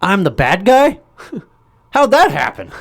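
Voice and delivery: high incredulous disbelief; mock bewildered. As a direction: realize you're the villain. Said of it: "He's like".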